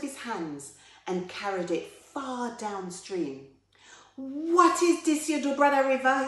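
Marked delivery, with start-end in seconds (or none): 4.2-6.3 high, loud outraged challenge; indignant protest. Acted. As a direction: call the thief out loudly. Anansi protests at River's theft.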